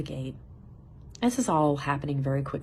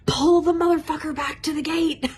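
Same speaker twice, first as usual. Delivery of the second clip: much higher and louder mock yell at the flight attendant; imagined outburst, shouted high and loud; pretend furious shout; 13 semitones higher, 9 dB louder than usual. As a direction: yell it like you've snapped. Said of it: "Of course I didn't cuss, but I felt like it".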